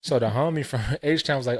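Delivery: ordinary delivery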